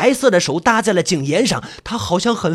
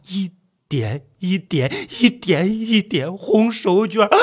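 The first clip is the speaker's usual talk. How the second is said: slow, trembling dread; creeping suspense; slower than usual. A narrator's reading. Narrator watching the hand inch upward, dreading. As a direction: draw each word out in dread.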